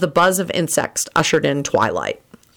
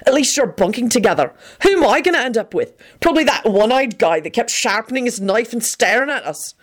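higher-pitched peevish griping; irritable; 6 semitones higher than usual. A narrator's reading. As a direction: gripe about your lot, pitch raised. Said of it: "Benji put in irritably".

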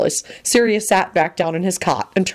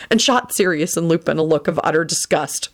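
ordinary delivery

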